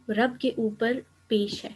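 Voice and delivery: ordinary talk, nothing marked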